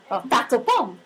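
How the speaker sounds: usual speaking voice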